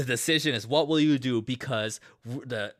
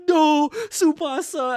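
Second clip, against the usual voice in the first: stretched, much higher and louder cry of no; anguished cry; 8 dB louder, slower than usual. Retold or imitated. Gaku crying out as Tsubasa gets attacked, slow anguished wail. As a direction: wail it out slowly as a friend falls.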